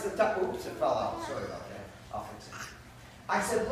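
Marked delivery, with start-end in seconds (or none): none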